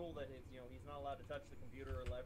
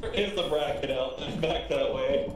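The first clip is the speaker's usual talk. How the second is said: shouting; 2 semitones higher, 15 dB louder than usual. Real, not acted. Yelling over chat.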